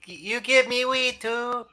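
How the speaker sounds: put-on high-pitched small child voice; slow, squeaky little-kid pleading. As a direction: pipe up like a six-year-old asking daddy. Player voices a six-year-old child.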